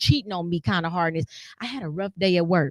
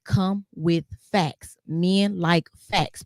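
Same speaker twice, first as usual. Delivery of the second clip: slow, firm and deliberate, each word laid down; measured like a mother teaching; slow emphatic advice, getting louder at the end; mom's lesson; 4 dB louder, slower than usual. Retold or imitated. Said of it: "my mom always taught me".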